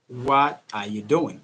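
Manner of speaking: every word sounded separately, slowly; measured, over-careful enunciation; slow, careful question. Shown as a demonstration. Teacher replays the long way for contrast, slow and careful. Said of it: "Don't take too much time".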